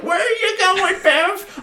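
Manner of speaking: very high mock grandma voice; shrieking grandma. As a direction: call after the grandson in a squeaky put-on voice. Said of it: "his grandma's like".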